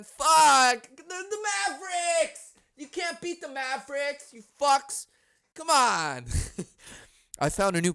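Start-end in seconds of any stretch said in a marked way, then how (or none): 0.0-6.3 higher, half-joking frustrated yell; yelling at the team. Real, not acted. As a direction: vent at the TV like a fan. Yelling at the TV about Mavericks.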